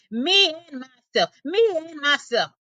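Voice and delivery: proud lion roar, a touch high. A narrator's reading. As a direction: chest out, boasting roar. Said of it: "he roars".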